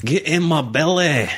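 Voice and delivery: high, slow Scottish growled demand; Fat Bastard. Acted. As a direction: draw it out in a thick Scottish accent. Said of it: "He's a Scottish guy".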